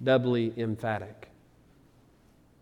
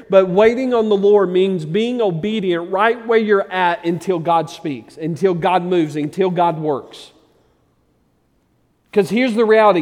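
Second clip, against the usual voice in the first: loud, raised sermon voice; full-force preaching; 7 semitones higher, 9 dB louder than usual. Real, not acted. Preacher voicing the psalmist's resolve to his congregation, full-force preaching. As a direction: preach it hard with driving stresses.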